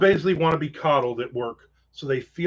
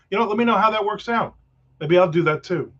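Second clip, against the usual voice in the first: ordinary talk, nothing marked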